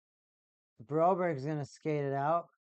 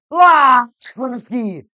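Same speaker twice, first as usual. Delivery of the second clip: much higher, much louder drawn-out shout of the name; excited shout; 6 semitones higher, 16 dB louder, much slower than usual. Real, not acted. Fan calling the goalie's save, long loud shout. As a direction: stretch the name into a big cheer.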